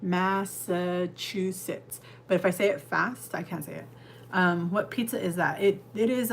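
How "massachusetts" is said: "Massachusetts" drawn out syllable by syllable, very slow; said slowly. Shown as a demonstration. Showing chat she can only say it slowly. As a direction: stretch every syllable of "Massachusetts" carefully.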